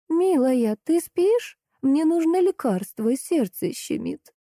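put-on high, slowed old-lady voice; Жозя. A narrator's reading. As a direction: plead gently in a high, drawn-out voice. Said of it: "тихий голос Жози спросил".

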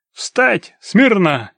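loud, high military shout; barked command. A narrator's reading. Demidov sobers up the clinging man with barked orders. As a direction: snap him to attention. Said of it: "рявкнул он".

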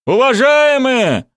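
high, loud, drawn-out call; shout from the street. Acted. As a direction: holler up to people overhead. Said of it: "послышался крик с улицы". (протяжный крик с улицы)

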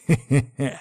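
brief self-satisfied laugh; short chuckle. A narrator's reading. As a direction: chuckle at being proved right. Рассказчик доволен своей правотой.

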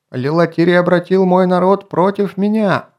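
high, loud furious cry; shouting. A narrator's reading. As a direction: shout it at the elder. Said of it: "крикнул Алиарий".

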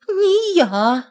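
high, scared little voice; barely breathing it out; breathless. Acted. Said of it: "проговорила, чуть дыша". (едва дыша)

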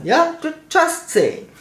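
high, pointed "Just saying"; sarcastic aside. Real, not acted. Mocking the staff about the oath, pointed and high.

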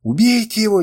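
high, loud shriek of rage. A narrator's reading. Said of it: "визжал Африс". (пронзительный визг)

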